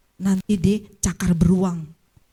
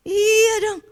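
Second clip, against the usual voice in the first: usual speaking voice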